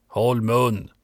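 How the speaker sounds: ordinary delivery, nothing marked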